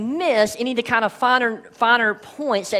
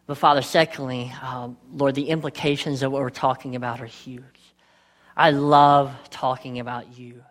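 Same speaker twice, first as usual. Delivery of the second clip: prayer; 7 semitones lower than usual. Acted. Opening prayer before sermon.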